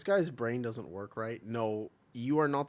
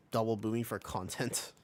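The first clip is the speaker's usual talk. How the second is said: ordinary delivery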